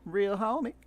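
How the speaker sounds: high-pitched southern country twang; southern cowgirl accent. Acted. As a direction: sound like a southern cowboy girl.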